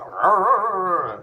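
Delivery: high, loud flailing alien squawk; Zoidberg squawk. Pretend. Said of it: "you could just go".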